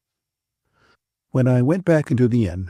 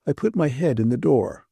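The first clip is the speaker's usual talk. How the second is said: usual speaking voice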